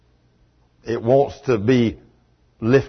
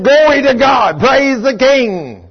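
high, loud, slow shouted praise; exuberant shout; 10 semitones higher, 8 dB louder, slower than usual. Retold or imitated. Healed Dave shouting praise to God, jubilant. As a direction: belt it out like a man set free. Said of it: "Dave throws up both hands".

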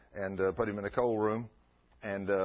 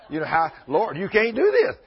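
rising into a loud, high plea to the Lord; pleading protest; 8 semitones higher, 10 dB louder than usual. Retold or imitated. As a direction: build from a protest into a cry. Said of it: "He said, "Lord".

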